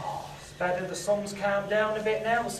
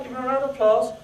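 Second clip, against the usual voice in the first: ordinary talk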